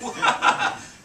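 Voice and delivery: loud burst of laughter. Real, not acted. Speaker amused by own story, loud laugh.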